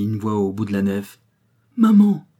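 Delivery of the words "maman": much higher, louder cry of Maman; child's cry. A narrator's reading. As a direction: call out to his mother with arms outstretched. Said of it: "cria Jean". (l'enfant crie Maman à sa mère, très fort et aigu)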